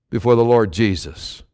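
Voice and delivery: ordinary delivery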